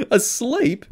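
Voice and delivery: high, loud incredulous asleep; amused disbelief. A narrator's reading. Friend laughing at the mum who sleeps through it, high loud disbelief. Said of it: "Sam burst out laughing again".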